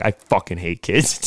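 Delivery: low, quiet, slow grumble; grumbled complaint. Retold or imitated. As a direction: say it under your breath, fed up. Exhausted friend grumbles about screaming kid.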